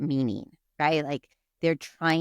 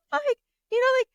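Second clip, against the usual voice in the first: laughing; faster than usual. Real, not acted. Laughing while telling family story.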